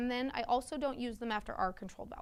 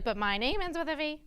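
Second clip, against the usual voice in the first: put-on a kid's protest, louder and higher; 6 semitones higher, 7 dB louder than usual. A student whose name breaks the spelling rule protesting. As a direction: object like an indignant student. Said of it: "He's like".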